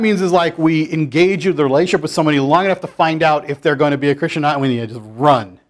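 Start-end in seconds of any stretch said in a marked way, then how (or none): none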